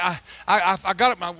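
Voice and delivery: ordinary talk, nothing marked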